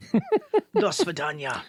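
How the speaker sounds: slow, hammed-up Russian accent. Popping in with the Russian for a joke, Russian accent. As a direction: draw it out in mock Russian. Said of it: "popped in with the Russian".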